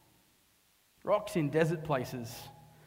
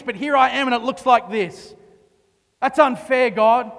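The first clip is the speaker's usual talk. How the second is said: louder and higher, ending in a near shout; angry prayer; 4 semitones higher, 6 dB louder than usual. Retold or imitated. Preacher voicing someone accusing God, building to a loud cry.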